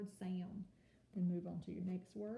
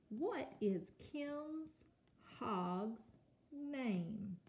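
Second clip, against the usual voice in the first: slow, drawn-out read; 4 semitones higher, much slower than usual. Shown as a demonstration. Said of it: "model reading of question".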